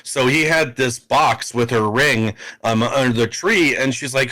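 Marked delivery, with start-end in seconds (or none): none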